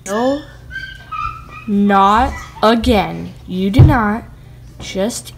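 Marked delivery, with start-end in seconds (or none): none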